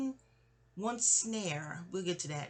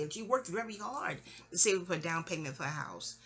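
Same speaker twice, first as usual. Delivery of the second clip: ordinary delivery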